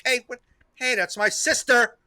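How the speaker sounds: high-pitched worried dog voice; concerned little dog. Retold or imitated. Little boy dog protesting as his sister is taken, concerned. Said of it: "very concerned".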